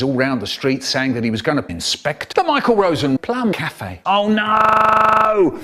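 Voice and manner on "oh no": loud, high, long-held wail; dread. A narrator's reading. Storyteller dreads the health inspector, long dramatic wail. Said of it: "The moment I dread".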